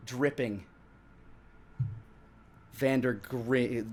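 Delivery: usual speaking voice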